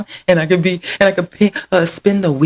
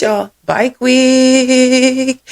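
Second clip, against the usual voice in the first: slow, stretched, much higher and louder; drawn-out hype call; 9 semitones higher, 4 dB louder, much slower than usual. Real, not acted. Vlogger hyping Bike Week to viewers, stretched excited call. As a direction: announce it like a big event.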